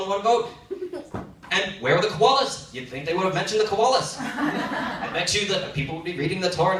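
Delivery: an amused rabbi who fully agrees; rabbi agreeing. Retold or imitated. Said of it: "Rabbi will look at you and go".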